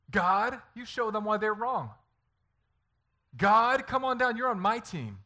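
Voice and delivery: high, loud demand to heaven; hammed-up outraged appeal; mock indignant shouting. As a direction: holler up like a tantruming child. Acts indignant appeal to God.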